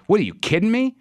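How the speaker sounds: high-pitched disbelieving question; acted-out "are you serious?" incredulity; incredulous. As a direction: retort in mock disbelief, pitch jumping up. Reacting to bad news from doctor.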